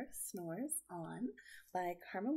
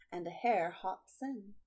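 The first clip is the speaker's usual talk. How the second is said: put-on slow, lilting read-aloud for children; storybook reading; 6 dB louder, slower than usual. A narrator's reading. Aunt reading a picture book to kids, gentle and lilting. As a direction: read it like bedtime for little ones.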